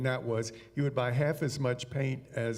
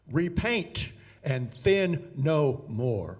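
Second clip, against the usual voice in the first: slow, solemn voice of God speaking from above; slow divine proclamation to the painter; 3 semitones higher, 4 dB louder, much slower than usual. Acted. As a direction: draw each word out like a heavenly pronouncement. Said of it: "this voice from above says".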